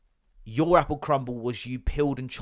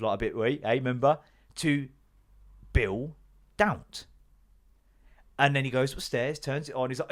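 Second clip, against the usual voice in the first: usual speaking voice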